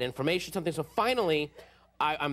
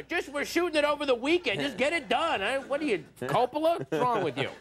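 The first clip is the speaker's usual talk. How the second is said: higher, pushy producer voice; a hint of a producer who wants it done; impatient producer; 8 semitones higher than usual. Producer scoffing at an artsy director, lightly voiced. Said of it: "There's, like, some producer".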